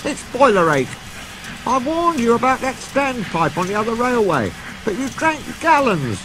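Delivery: a high, chirpy little engine; know-it-all friend lecturing kindly; Duck, knowing. Acted. Duck telling Gordon about boiler ache, chirpy and high.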